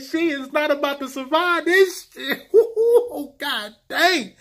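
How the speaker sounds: high, laughing disbelief; laughing excitedly. Real, not acted. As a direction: laugh through the words.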